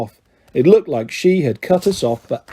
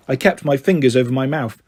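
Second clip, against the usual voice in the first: usual speaking voice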